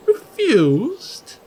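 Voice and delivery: Refused? pitched high with a rising question; mock-outraged Refused?; indignant disbelief. Real, not acted. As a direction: echo Refused? in affronted disbelief. Indignant echo of read word.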